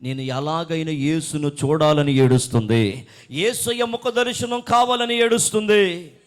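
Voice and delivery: loud, climbing preacher's delivery; full-force preaching. Real, not acted. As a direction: swell into a fervent proclamation. (గట్టిగా, ఆవేశంగా ప్రసంగం)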